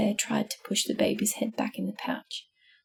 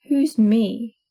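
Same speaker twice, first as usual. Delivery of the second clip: a louder, gruff demand; growled; 6 dB louder than usual. A narrator's reading. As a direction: bark it back, louder. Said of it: "growled Mister Bear".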